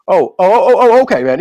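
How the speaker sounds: loud, high sarcastic backing-off; hammed-up, exaggerated "well, excuse me" reaction; mock offended. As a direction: act put out at being corrected. Acted offended reply to being corrected.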